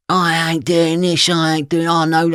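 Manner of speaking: high whiny put-on voice; mocking the club owner. Mocking the club owner refusing to apologise, high and whiny. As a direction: make the owner sound feeble and scared.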